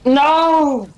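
high, drawn-out no; dismayed cry. Real, not acted. Player killed in favourite spot, dismayed cry.

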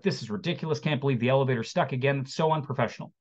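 ordinary talk, nothing marked

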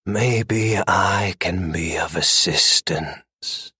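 low, slow purr from the dark. A narrator's reading. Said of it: "purred a smooth female voice from the darkness".

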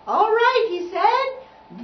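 high, loud order to the players; conductor's command. A narrator's reading. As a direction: call the orchestra to attention. Conductor commands orchestra to start.

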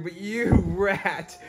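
ordinary delivery

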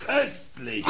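hoch und kichernd vor Vergnügen; lachend. Acted. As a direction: gackere vor Freude los. König begeistert vom Streich. (der König gackert vergnügt über den Maskenscherz)

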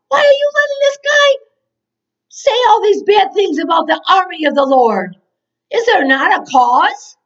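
high, loud, impassioned challenge; indignant protest. Acted. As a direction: protest the insult as young David would. Acting David protesting to brothers.

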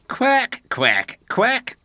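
high duck quacking; mocking quack. Donald teases Duck by quacking.

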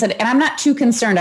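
ordinary delivery

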